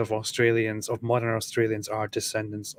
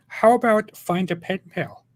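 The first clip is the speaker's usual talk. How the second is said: high angel voice; 8 semitones higher, 6 dB louder than usual. Retold or imitated. Acted angel line.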